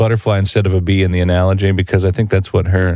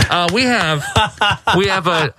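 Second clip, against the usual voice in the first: slow, higher PA announcer drone; grocery store announcer; 8 semitones higher, slower than usual. Pretend. Host imagining himself paging the grocery store, slow announcer drone. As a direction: page the shoppers like a store intercom. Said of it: "act like I'm the announcer in the grocery store".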